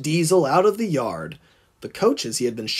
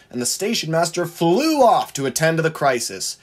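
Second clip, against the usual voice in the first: ordinary delivery, nothing marked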